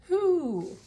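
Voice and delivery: high, loud owl hoot. Pretend. Teacher hooting like an owl for the joke.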